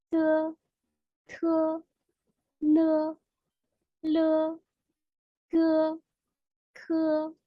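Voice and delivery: syllables repeated on a high, level pitch; steady high monotone with pauses between syllables; pronunciation drill. Shown as a demonstration. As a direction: model each Mandarin initial, drawn out evenly. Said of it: "Je répète".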